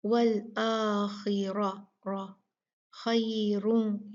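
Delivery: वल आखिरा रा खैरुन slow, both ras heavy; very slow Quranic recitation, heavy ra. Shown as a demonstration. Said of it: "मोटे पढ़े जाएंगे".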